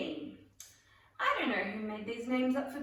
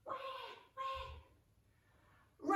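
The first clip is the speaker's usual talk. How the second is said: mock baby cry; 9 dB quieter than usual. Leader demonstrates baby bean to players, mock baby wailing. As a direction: pretend infant wail.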